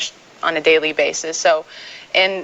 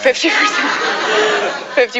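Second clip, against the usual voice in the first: laughing; 11 semitones higher, slower than usual. Real, not acted. Laughing correction of herself.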